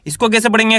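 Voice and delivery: ordinary talk, nothing marked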